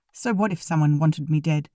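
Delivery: usual speaking voice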